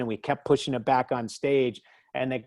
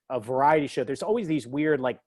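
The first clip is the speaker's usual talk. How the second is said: ordinary talk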